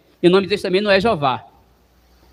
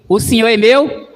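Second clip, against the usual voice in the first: louder lead line with a rise on meu; prompt to repeat; 5 dB louder than usual. Shown as a demonstration. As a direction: lead them in, lifting meu.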